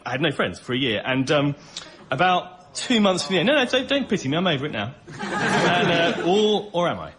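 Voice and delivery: normal talking voice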